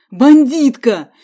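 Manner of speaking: loud, slow drawn-out yell; frightened yell. A narrator's reading. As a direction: scream the word Бандитка in fear. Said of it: "со страхом закричал". (громко и протяжно: Бандитка)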